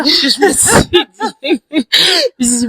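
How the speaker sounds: high-pitched, breaking up with laughter; amused, giggly retelling; laughing while talking. Real, not acted. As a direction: laugh your way through the words. Laughs while telling her puzzlement.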